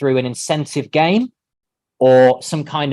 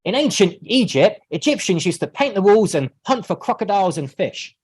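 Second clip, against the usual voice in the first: higher, cheerful reading; happy voice; 4 semitones higher than usual. Shown as a demonstration. Students read the text to their partner in a happy voice. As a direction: read it high and happily. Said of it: "with that happy voice".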